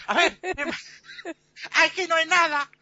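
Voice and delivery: high-pitched exclamation broken by laughter; indignant protest. Acted. Captain looks for the rats and finds nothing, high indignant cry. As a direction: protest incredulously, amused underneath.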